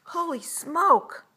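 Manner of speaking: loud, high, drawn-out astonishment; alarmed cry. A narrator's reading. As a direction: exclaim like a startled kid. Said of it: "cried Spud".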